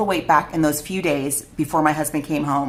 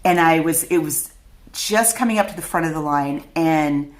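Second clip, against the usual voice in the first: usual speaking voice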